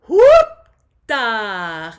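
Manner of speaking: loud, high head-voice whoop. Shown as a demonstration. Voice teacher showing the class a head-voice whoop. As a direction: fling it up into head voice. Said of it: "do a head voice or a higher voice".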